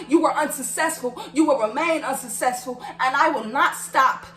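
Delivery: forceful, proud vow to fight back; defiant declaration. Real, not acted. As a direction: hammer each phrase at the one who wronged you. Defiant address to Jacoby.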